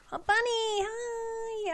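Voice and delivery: high, squealing hello to a bunny; delighted greeting. Real, not acted. Player spotting a bunny she meant to save, high and delighted. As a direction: squeal with joy at a cute animal.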